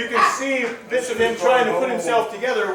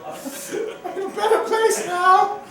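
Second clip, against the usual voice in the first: high, giggly spirit voice; a happy little ghost; 10 semitones higher than usual. Acted. Spirit speaks.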